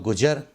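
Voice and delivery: 'गुजर' with j instead of z; common mispronunciation. Shown as a demonstration. Teacher quotes what people say, mispronounced. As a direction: say 'गुजर' as gujar. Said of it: "बोलते हैं लोग".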